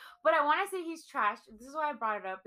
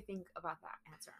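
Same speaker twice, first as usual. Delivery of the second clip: whisper; 6 semitones lower, 14 dB quieter than usual. Real, not acted. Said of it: "whispered question".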